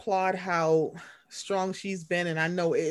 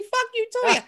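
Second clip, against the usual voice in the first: drunk mock outburst. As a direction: go off on Toya, drunk. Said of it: "Who the fuck".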